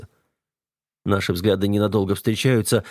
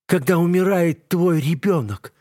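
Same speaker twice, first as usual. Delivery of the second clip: anguished cry; 9 semitones higher than usual. A narrator's reading. Eva cries out in pain.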